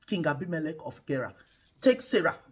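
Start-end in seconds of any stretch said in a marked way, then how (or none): none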